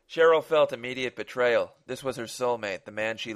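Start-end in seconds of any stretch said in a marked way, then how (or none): none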